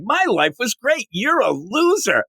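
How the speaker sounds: lightly voiced high taunt; gloating Lex. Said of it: "he is laughing it up".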